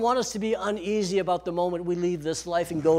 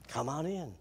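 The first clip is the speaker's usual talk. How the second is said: much softer, tender invitation; gentle welcome; 10 dB quieter than usual. Retold or imitated. Heavenly Father welcoming a believer, gentle and soft. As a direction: gently let them in. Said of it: "gently say".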